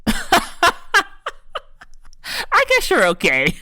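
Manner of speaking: giggling through a grudging compliment; teasing her husband, laughing; laughing tease. Retold or imitated. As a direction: laugh it out playfully. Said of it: "I was like".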